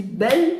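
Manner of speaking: "belle" short, with a rising tune; "belle" without holding the L; one short syllable. Shown as a demonstration. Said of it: "une syllabe".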